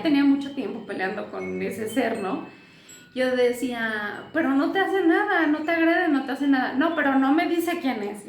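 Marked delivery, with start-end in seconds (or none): 4.3-6.7 higher, louder retelling of her own protest; mother's retold protest, pitched up and loud, easing at the end; pleading with her son. 6.7-8.3 higher and louder, a mimicked child's retort; son's retort, voiced a little higher and louder; son's stubborn answer. Son answers back in the argument.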